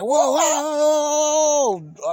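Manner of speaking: drawn-out, high, loud whoas, very slow, fading at the end; scared whoa. Retold or imitated. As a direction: stretch each whoa out high and loud to hold back a charging dog. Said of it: "And I'm like".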